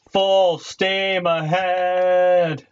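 high, very slow captain's call; drawn-out battle cry. Pretend. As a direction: stretch it out like a ship captain.

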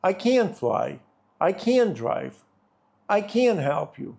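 "can't" lifted and stressed in each line; American negative "can't" demo. Shown as a demonstration. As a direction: hit "can't" clearly for the negative. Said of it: "В запереченні".

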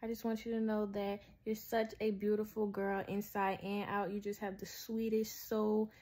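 ordinary talk, nothing marked

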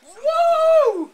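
loud high cheering whoop. Real, not acted. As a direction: let out a big excited woo. Cheering for pizza.

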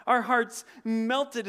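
mock alarm, loud and slow; emphatic. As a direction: hit each word of the panic. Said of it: "It says, basically, they all freaked out".

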